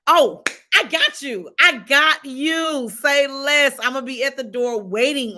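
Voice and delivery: loud, high, stretched-out promise; emphatic excitement. Real, not acted. Host promises a viewer she has got them, emphatic excitement. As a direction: assure them eagerly, leaning on each word.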